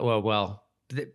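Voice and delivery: ordinary delivery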